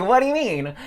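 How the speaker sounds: loud, high-pitched demand; incredulous curiosity. Retold or imitated. Retelling how she pressed the guy, loudly incredulous. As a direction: blurt it out loud and high, dying to know. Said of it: "freaking curious".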